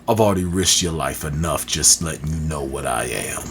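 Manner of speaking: brash enforcer introducing his own story; rough-talking enforcer. Acted. Story character introduces the reading.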